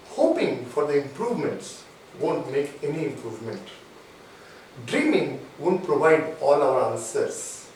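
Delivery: measured, low-pitched dictation; very slow. Real, not acted. Coach gives lines to be written down, slow and measured. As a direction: pause between phrases so they can write. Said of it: "I'll speak very slowly".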